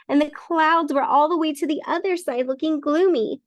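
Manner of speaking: usual speaking voice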